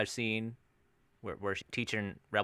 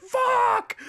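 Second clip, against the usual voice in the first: very high, loud yelled curse; mock blow-up scream; 8 dB louder than usual. Actress flipping off the plane on the tarmac, imitated scream. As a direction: imitate a movie meltdown shriek. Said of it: "her blow-up scene on".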